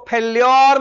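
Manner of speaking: "फेल्योर" squashed into fel-YOR; mispronounced failure. Shown as a demonstration. Teacher warning students off a misreading, mispronounced "फेल्योर" as fel-YOR. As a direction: drag out the second syllable of "फेल्योर". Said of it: "इसको फेल्योर मत पढ़िएगा".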